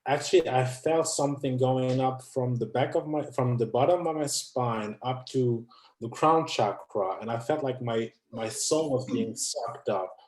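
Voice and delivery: ordinary talk, nothing marked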